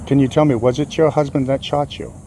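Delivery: ordinary delivery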